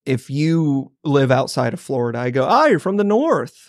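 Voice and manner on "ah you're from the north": higher, teasing pronouncement; mock accusation. Floridian tells anyone from outside Florida, mock accusing. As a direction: pitch it up like a playful verdict. Acts out his verdict on outsiders.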